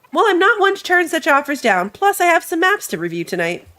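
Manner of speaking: lightly voiced friendly sea trader; ship merchant voice. Merchant replies.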